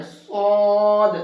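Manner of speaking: صاد thick and heavy, long and drawn out; thick saad. Shown as a demonstration. Teacher showing students a thick صاد. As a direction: round the mouth and say صاد heavy. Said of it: "اس کو موٹا کر کے پڑھنا ہے".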